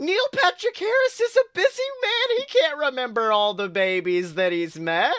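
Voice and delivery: high, sarcastic defense of a celebrity; sarcastic high defense of Neil Patrick Harris; mock defense. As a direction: pipe up in a mock-earnest excuse. Mocking excuse for celebrity.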